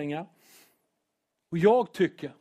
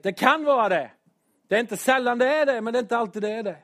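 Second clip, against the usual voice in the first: louder and higher, driving the point home; shouting; 6 semitones higher, 4 dB louder than usual. Real, not acted. Preacher getting carried away before his church, shouting. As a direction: raise the voice to hammer it in. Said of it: "Oj, vad jag skriker".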